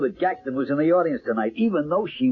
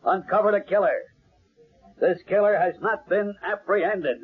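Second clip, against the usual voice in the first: showman's introduction; 5 semitones higher than usual. Acted. Announcer presenting the psychic to the audience, grand and booming. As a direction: build the crowd up for the star act.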